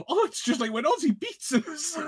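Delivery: high-pitched put-on woman's quote; high, hammed-up mock voice; mock Sharon Osbourne. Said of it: "she just looks at the camera and just like".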